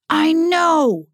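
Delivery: loud, high anguished yell. Acted. Julian pushed past breaking point by Adam, yelling. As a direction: burst out at full voice. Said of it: "Julian yelled".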